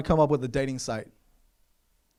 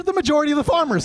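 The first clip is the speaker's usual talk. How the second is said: insistent outburst; 11 semitones higher, much faster than usual. Acted. Comic telling the crowd, high fast insistence. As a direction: hammer the point home fast.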